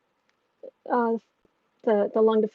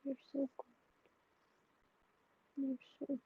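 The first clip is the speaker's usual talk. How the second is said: soft, hushed cooing to a pet; pet praise; 4 semitones higher, 14 dB quieter than usual. Real, not acted. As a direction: croon praise at the dog.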